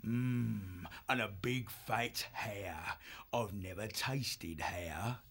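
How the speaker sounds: very soft, low, near-whispered relish; savouring menace. Acted. Foxwolf eyeing the fat hare, hushed hungry menace. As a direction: linger hungrily and slowly over it. Villain savours thought of eating captive.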